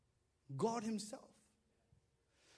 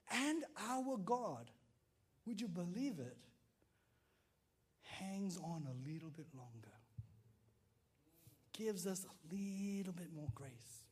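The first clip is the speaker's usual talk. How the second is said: usual speaking voice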